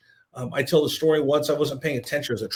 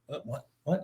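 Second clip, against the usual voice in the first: much softer, puzzled reply; caught off guard; 11 dB quieter than usual. Retold or imitated. Reporter surprised by the manager's question, caught off guard. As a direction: drop the volume, as if caught out.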